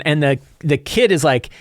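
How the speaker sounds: ordinary talk, nothing marked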